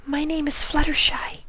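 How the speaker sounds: high, breathy whisper of a timid pony; gentle, shy, barely daring to ask; Fluttershy's voice. A narrator's reading. Fluttershy introduces herself.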